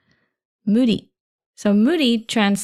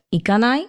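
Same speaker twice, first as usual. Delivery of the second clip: 行かない pitched up into an inviting question; rising question intonation; 4 semitones higher, much faster than usual. Shown as a demonstration. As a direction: raise the end of 行かない to ask someone along. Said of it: "asking somebody to go".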